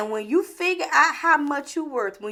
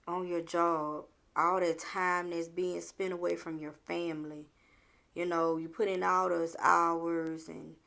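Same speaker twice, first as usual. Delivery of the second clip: soft reflection; 7 semitones lower, 9 dB quieter than usual. Acted. Talking quietly to viewers about time lost at work. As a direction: lean in and speak softly to the viewer.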